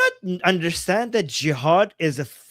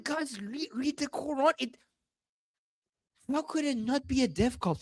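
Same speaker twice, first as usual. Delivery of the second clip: usual speaking voice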